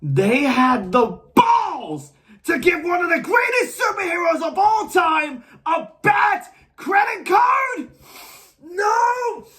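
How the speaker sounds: shouted rant climbing into high cries; furious outburst. Acted. Critic outraged at a superhero's credit card, furious outburst. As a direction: lose it completely, breaking down into frantic repeats. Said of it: "I apologize for that last outburst".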